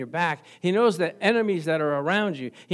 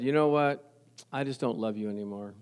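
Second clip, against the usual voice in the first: cold spouse; 8 semitones lower, 8 dB quieter than usual. Retold or imitated. Spouse voiced falling out of love.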